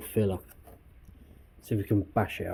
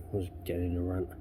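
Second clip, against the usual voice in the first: normal talking voice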